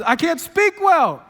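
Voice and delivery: high, loud, slow mock whine; mock Moses pleading he can't speak; whiny mocking excuse, drawn out. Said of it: "Moses says".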